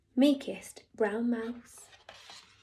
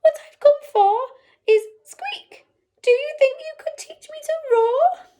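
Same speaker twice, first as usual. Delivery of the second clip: nervous squeaky mouse; 12 semitones higher, 7 dB louder than usual. Acted. Tiny mouse asks to learn roar.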